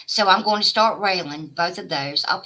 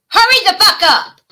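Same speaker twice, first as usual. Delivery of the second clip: yelled much higher and louder; angry shout; 12 semitones higher, 9 dB louder than usual. Real, not acted. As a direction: scream the demand. Yelling at rival streamer.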